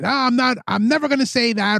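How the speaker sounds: high, huffy retort; mock stubborn refusal. Acts out argument retort.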